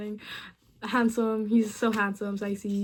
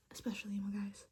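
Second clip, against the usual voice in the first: whispered aside; 2 semitones lower, 15 dB quieter, faster than usual. Real, not acted. Cheeky aside about emo guys.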